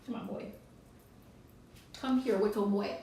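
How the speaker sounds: soft coaxing call to a pet. Real, not acted. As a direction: gently coax the bird over. Coaxing pet bird to come.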